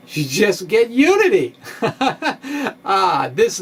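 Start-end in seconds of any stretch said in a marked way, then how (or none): 0.1-1.8 amused, laughing through the words. Real, not acted. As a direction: let the laugh spill over the line. Laughs at the surprising result.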